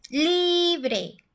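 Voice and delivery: "Libre" with a long, high, punched LI; stress on first syllable. Shown as a demonstration. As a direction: stretch the LI of "Libre" high, then drop on bre. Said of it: "la sílaba tónica está en la li".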